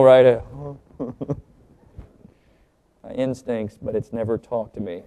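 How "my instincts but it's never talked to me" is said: very soft, low repeat; quiet, low recap of a joke's punchline; murmured punchline. Retold or imitated. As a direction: say it under your breath. Murmurs punchline back after joke.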